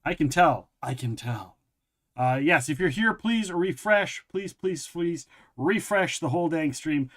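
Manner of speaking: ordinary talk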